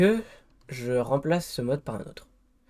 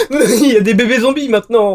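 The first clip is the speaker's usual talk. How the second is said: laughing high and loud in disbelief; hysterical laughing; 11 semitones higher, 13 dB louder than usual. Real, not acted. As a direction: laugh it out, panicked. Baby zombies appear, hysterical.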